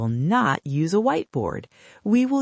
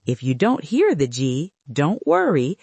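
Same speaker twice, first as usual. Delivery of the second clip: normal talking voice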